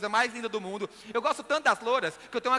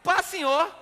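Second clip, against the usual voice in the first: loud, higher Mineiro reply, endings swallowed; emphatic Minas Gerais accent, "senhor" as "sinhô"; mineirês; 7 semitones higher, 6 dB louder than usual. Retold or imitated. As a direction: call it back in broad Minas dialect. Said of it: "ele fala o mineirês".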